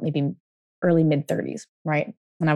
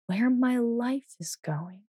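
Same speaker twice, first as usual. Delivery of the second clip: much softer, slow mutter under the breath; muttering to herself; 4 semitones higher, 10 dB quieter, slower than usual. Retold or imitated. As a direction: murmur it to yourself, lost in thought. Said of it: "She keeps muttering to herself".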